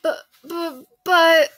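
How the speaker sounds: high, stammering protest; sputtering. A narrator's reading. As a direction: sputter it out, lost for words. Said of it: "sputtered Anna".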